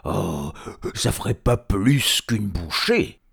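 loud, slow troll voice; lumbering troll, dragging the words; unimpressed troll. Acted. Léon judging the tiny meal, slow troll voice.

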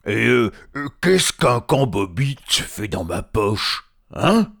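loud, halting troll voice; slow, stumbling question with a high "hein"; dim-witted troll. Acted. Troll finding a hobbit in his pocket, loud puzzlement.

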